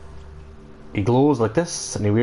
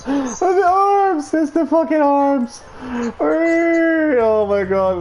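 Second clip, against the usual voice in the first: excited laughing shouts; slower than usual. Real, not acted. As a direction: shriek with giddy joy. Ecstatic about the game's arms.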